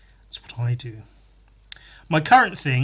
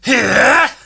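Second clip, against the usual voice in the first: Link yell; 7 semitones higher, 12 dB louder than usual. Retold or imitated. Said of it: "I do Link noises from Zelda".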